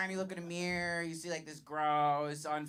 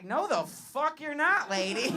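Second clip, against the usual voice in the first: higher, louder, slowed-down defiance; mock indignant retort; 10 semitones higher, 7 dB louder than usual. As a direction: snap back at the stepmother, hammed-up. Comic acts defiant stepchild's retort.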